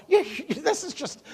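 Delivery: flustered laughing stammer on the phone. Retold or imitated. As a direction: stumble over the words, laughing nervously. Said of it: "And I'm stuttering, stammering".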